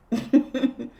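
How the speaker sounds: light little laugh; amused chuckle. Real, not acted. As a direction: chuckle to yourself. Chuckles after unbothered reply.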